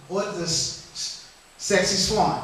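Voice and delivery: unsure mumble of "sexy swan"; stumbling over name. Real, not acted. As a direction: fumble the dish name quietly. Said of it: "I can't really say it".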